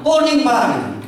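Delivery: high, playful wife voice; a wife who has already decided, pitched high; mock wife insisting. Wife overrules husband on dress colour, high and insistent.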